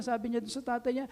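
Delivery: ordinary talk, nothing marked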